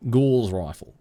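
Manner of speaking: "Gauze" with a long aw; Gauze pronunciation. Shown as a demonstration. Streamer settling what sounds right, "Gauze" with aw. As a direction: say "Gauze" with aw, as preferred. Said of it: "no, it doesn't sound right".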